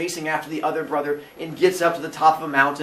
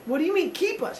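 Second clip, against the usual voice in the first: much higher, small puzzled voice; confused Cyclops; 13 semitones higher, 3 dB quieter than usual. Acted. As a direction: ask like a baffled kid monster. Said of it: "And the Cyclops go".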